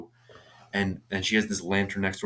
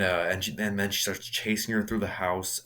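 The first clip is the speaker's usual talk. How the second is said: ordinary delivery, nothing marked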